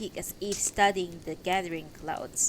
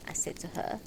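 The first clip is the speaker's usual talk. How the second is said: usual speaking voice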